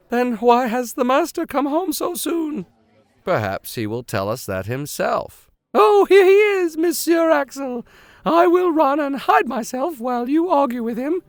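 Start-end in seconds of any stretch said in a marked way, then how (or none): 0.1-2.7 high, worried maid's voice; fussing housekeeper. Acted. Martha fretting to the nephew, high and worried. 5.7-11.2 high, worried maid's voice; fussing housekeeper. Acted. Martha fretting to the nephew, high and worried.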